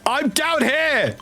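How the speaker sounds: high, slow drawn-out call. Real, not acted. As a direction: shout down to a teammate far off. Calling teammate from below.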